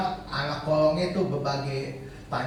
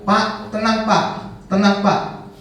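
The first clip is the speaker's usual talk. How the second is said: loud, higher-pitched appeal to settle down; retold urgent calming; 6 semitones higher, 7 dB louder, slower than usual. Organiser to angry angkot drivers, retold loud calming appeal. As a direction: call out to calm an angry crowd.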